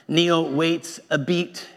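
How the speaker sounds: usual speaking voice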